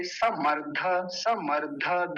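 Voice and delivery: समर्ध drawn out with a complete "dha" at the end; slow "samardha", last syllable whole; full final dha. Shown as a demonstration. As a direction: sound the vowel after ध in समर्ध. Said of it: "ध पूरा है इसमें".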